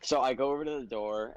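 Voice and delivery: usual speaking voice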